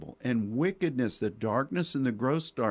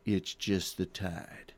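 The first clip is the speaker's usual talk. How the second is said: slow, low Texan drawl, Tommy Lee Jones style; 6 semitones lower, 4 dB quieter, slower than usual. Retold or imitated. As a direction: drag it out low like a weary Texas sheriff. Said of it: "Tommy Lee Jones says".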